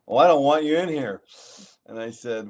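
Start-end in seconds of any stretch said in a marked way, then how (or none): 0.1-1.2 higher, louder stern refusal; retold angry coach. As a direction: lay down the law like an annoyed coach. Coach confronts him in gym.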